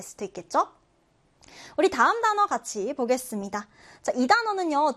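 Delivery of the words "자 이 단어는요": normal talking voice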